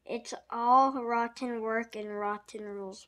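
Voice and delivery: usual speaking voice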